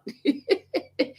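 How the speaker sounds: light amused laugh; a quick real chuckle; short laugh. Real, not acted. Laughs at long livestream.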